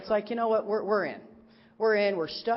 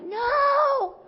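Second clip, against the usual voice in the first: mock flat no; 3 dB louder than usual. Silent answer to pilot's question.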